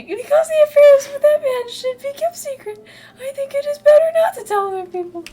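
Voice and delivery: loud, very high falsetto; hammed-up high, loud servant; flustered servant voice. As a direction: blurt it out as a squeaky attendant. Reads Phil's lines in voice.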